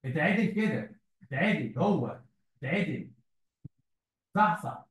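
usual speaking voice

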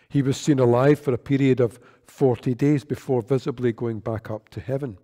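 ordinary talk

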